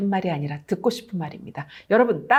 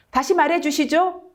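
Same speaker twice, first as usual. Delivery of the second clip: higher, curt 다시 말해주시죠; blunt demand; 6 semitones higher than usual. Shown as a demonstration. Lecturer showing the rude way to ask for a repeat, curt and higher. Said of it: "이렇게 하는 것보다는".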